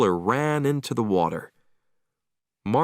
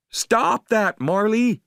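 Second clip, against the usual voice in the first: high, loud call to the dog; crying out; 12 semitones higher, 4 dB louder, slower than usual. A narrator's reading. Owner yelling at Marley drinking seawater, loud alarmed call. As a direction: shout across the water in alarm. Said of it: "I cried".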